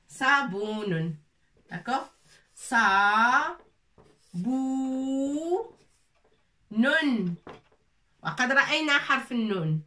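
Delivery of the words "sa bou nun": sa, bou, nun split into drawn-out syllables. Shown as a demonstration. Teacher spelling saboun for the pupils syllable by syllable. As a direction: spell the word out slowly, one beat each. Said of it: "c'est sabon".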